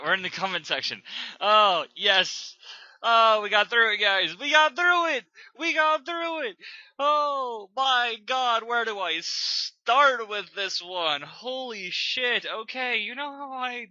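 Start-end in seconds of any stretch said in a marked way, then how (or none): 1.4-6.6 loud, high cries of triumph; relieved celebration. Real, not acted. Reader to viewers on finishing a bad fanfic, triumphant shouting. 7.0-12.4 loud, high, drawn-out disbelief; exasperated outburst. Real, not acted. Reviewer starting on a terrible fanfic, loud exasperation.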